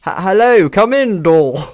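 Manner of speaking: very loud, high mock-British greeting; butchered British accent. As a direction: ham up a terrible British accent. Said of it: "I'm gonna do my butchered accent".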